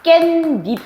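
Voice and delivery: 깻잎 slow and high, as 깬닙; inserted n pronunciation. Shown as a demonstration. Teacher answering how it sounds, 깻잎 with doubled ㄴ. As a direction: draw 깻잎 out as kkaen-nip, double n. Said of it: "얘 발음 어떻게 나?".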